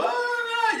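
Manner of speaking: long, high-pitched mock wail; imitated scream. Preacher retelling how a sister interrupted him, mock scream. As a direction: mimic a woman's sustained cry. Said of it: "esa hermana gritando".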